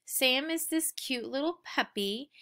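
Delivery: ordinary delivery, nothing marked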